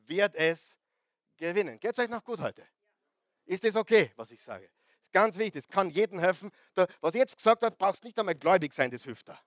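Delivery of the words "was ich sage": ordinary talk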